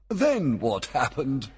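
high, slow eager question; eager amusement. A narrator's reading. Delighted king urges Puck on.

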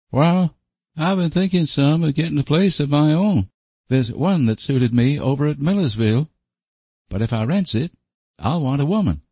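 high-pitched country fellow, earnest; solemn rustic suitor. Acted. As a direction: state your plans in a high rustic voice. Voices Sam, solemn suitor.

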